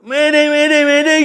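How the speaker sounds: loud, high-pitched distress yell; acted-out cry for help over the radio; imitated mayday shout. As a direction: shout mayday like a panicking passenger in a story. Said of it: "Dia teriak ya".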